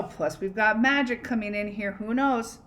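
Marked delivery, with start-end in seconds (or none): none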